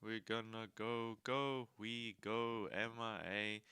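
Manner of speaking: slow, flat robot voice. Pretend. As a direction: drone it like a robot. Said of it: "This is the robot voice".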